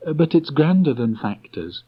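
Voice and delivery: put-on a boy hurrying to cheer his bear; Christopher Robin's voice. A narrator's reading. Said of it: "he added quickly".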